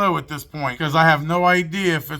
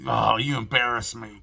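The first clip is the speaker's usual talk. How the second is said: disgusted wife; 7 semitones lower, 4 dB quieter than usual. Retold or imitated. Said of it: "shaking her head, just, like, in disgust".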